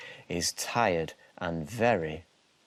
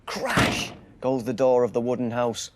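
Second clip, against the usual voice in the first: ordinary delivery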